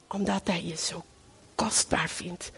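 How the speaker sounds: whispered and tender; soft intimate whisper. Acted. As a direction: whisper it like a secret. Tender aside to listeners.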